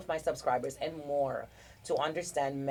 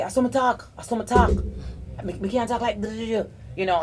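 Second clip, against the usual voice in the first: higher, louder husband voice, heated; imitating husband; 7 semitones higher, 5 dB louder than usual. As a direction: mimic the husband getting worked up. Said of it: "the way my husband speaks".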